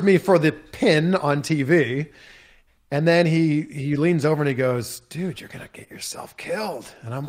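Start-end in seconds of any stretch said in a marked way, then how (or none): 5.0-6.9 much softer, leaned-in aside; hushed warning from the wrestler; quiet warning. Retold or imitated. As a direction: murmur it close to his ear. Leans over during the pin.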